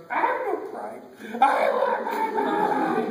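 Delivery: loud, high wailing shout; bawling child. Acted. Crying child wanting his mama, loud wail. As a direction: drag it out as a hammed-up bawl. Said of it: "don't sit your crying".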